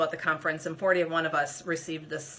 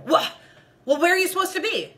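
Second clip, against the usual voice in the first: much higher, fast, impatient demand; harsh volunteer; 10 semitones higher, 5 dB louder, faster than usual. Retold or imitated. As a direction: hurry her, exasperated and sharp. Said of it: "she was so harsh".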